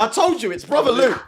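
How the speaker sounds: big up your mate; hype. Real, not acted. Host hyping the guest's song, excited. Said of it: "hyping the guest".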